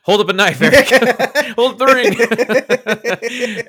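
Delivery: loud laughing calls to Eric; laughing tease. Real, not acted. Friend teasing Eric for holding a ring, laughing loudly.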